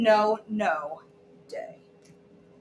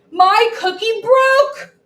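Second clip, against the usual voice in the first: high, loud, slow wail; cookie wail; 11 semitones higher, 10 dB louder, slower than usual. Acted. Child wailing to her friend over a broken cookie, high and loud. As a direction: wail it like the world ended.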